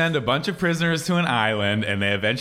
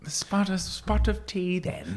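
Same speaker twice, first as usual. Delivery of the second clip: high, soft put-on British accent; bad British accent; 4 semitones higher, 6 dB quieter, slower than usual. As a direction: do a dainty, awful British accent. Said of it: "your British accent is awful".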